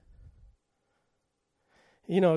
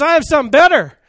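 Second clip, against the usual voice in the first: high, shouted preaching climax; loud proclamation; 8 semitones higher, 11 dB louder, faster than usual. Acted. Preacher announces God's answer in campus revivals, loud and exultant. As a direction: ring it out at full force, pitched high.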